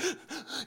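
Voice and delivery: sharp, high, voiceless intake of breath; imitated sob gasp. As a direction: mimic the catching breath of heavy crying. Imitates uncontrollable crying breath.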